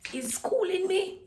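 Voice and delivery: high, slow put-on squeak; squeaky high voice. Vlogger complaining about school in a silly high voice. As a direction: squeeze it up into a tiny high voice. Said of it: "I kinda like my voice like this".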